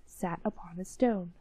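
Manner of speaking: ordinary delivery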